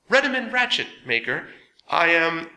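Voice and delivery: high, loud nervous announcement; Ratchet's formal greeting. A narrator's reading. Ratchet presenting himself to the council, high and loud. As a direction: announce yourself to the council, a bit too eager.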